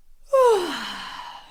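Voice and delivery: high, loud yawn falling into a sigh. Shown as a demonstration. Coach leads the class in trying a yawn, yawning sigh. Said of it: "So you do a yawn".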